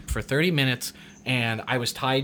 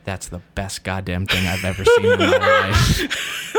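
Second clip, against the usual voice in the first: impressed director; 5 semitones lower, 4 dB louder than usual. Retold or imitated. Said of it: "my artistic director looks at me and goes".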